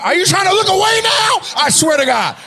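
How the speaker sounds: loud, high threatening yell; hammed-up high outburst; mock outraged shout. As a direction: yell as if catching someone out. Mock threat to audience member looking away.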